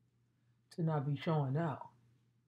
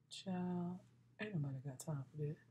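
ordinary delivery

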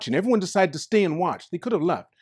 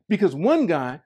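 normal talking voice

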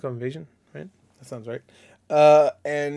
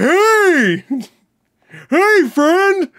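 jolly pumpkin greeting; 8 dB louder, much slower than usual. Retold or imitated. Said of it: "He's like".

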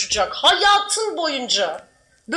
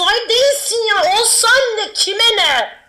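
exasperated scolding; 5 semitones higher, 7 dB louder, slower than usual. Real, not acted. Scolding the viewer loudly.

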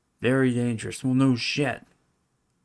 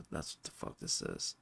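"That's what the fuck this is" whispered under the breath, much softer and lower; whispered repeat; 14 dB quieter than usual. Real, not acted. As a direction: breathe it to yourself in disgust. Repeats the line under his breath.

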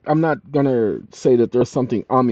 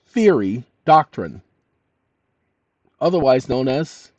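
dictating. Shown as a demonstration. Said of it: "Now, watch this".

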